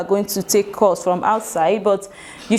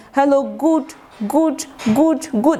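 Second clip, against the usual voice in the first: higher, stalling on GOOD; hesitant, unsure greeting; 5 semitones higher than usual. Shown as a demonstration. Trainer imitating unprepared call-taker unsure of the time, hesitant. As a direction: repeat GOOD haltingly as if searching for the time of day. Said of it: "The person is trying to know".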